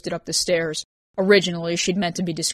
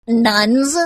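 loud, high rising question; mocking one-word query; incredulous amusement; 5 semitones higher, 7 dB louder than usual. A narrator's reading. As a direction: echo it in delighted disbelief. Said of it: "Vance choked back a laugh".

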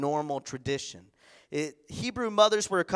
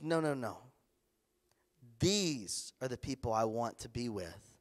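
softer, lower, tender welcome; gentle reassurance; 5 semitones lower, 6 dB quieter than usual. Retold or imitated. Jesus to the children he wants near him, soft and tender. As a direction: gently soothe them as Jesus.

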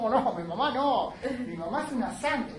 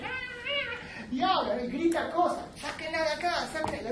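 put-on shrill, much higher old-woman cries; crazy old woman; 8 semitones higher than usual. Lecturer voicing the mad old woman from Lorca. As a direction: play the madwoman shouting to be dragged off. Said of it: "la vieja que está loca y dice".

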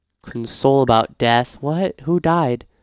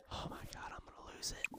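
exasperated whisper; 30 dB quieter, faster than usual. Real, not acted. Gamer at the end of their patience with the sims, whisper. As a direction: whisper it, at the end of your rope. Whispered after sandwich vanishes.

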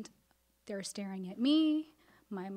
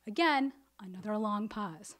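usual speaking voice

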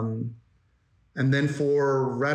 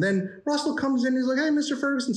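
put-on high, quick eager boy; high kid voice; 12 semitones higher than usual. Story artist pitching the boy's line, high and fast. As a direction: pipe it up like an excited little kid. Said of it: "trying to do these, like, bullcrappy voices".

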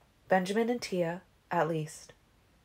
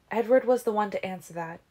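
usual speaking voice

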